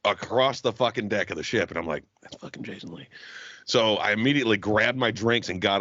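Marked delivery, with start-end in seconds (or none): 2.2-3.1 hushed, starstruck murmur; awed under-breath aside. Retold or imitated. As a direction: say it quietly to yourself in disbelief. Spotting a celebrity across deck.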